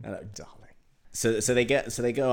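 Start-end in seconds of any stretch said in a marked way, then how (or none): 0.1-0.7 whispered hello darling. Pretend. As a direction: breathe it close and hushed. Whispered line.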